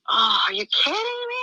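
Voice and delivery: high, loud excited cry; joyful disbelief. Retold or imitated. Reacting to the Lord's permission to leave, thrilled. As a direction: burst out in delight.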